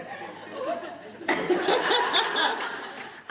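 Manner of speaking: real high delighted laugh. Real, not acted. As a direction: burst of high breathy laughter. Laughs at soror working.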